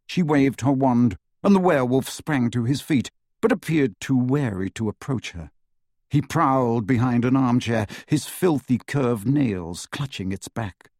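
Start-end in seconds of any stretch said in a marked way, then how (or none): none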